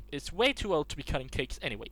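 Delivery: ordinary talk, nothing marked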